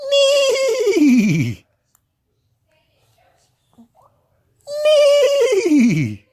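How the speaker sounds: very high, loud whinny falling away; shrieking wavering whinny; horse neigh. Acted. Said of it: "And a horse says".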